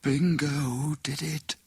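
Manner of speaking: slow, strained, dying whisper. Acted. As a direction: force out the words with last strength. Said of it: "she said in a strained whisper".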